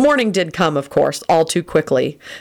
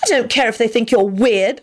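higher, blunt insistence; tactless; 7 semitones higher than usual. A narrator's reading. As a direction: blurt it without tact. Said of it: "she added tactlessly".

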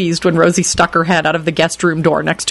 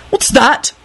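suspicious; 8 semitones higher than usual. A narrator's reading. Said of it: "Neville asked suspiciously".

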